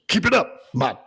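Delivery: mock boss voice; Vince impression. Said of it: "he just goes".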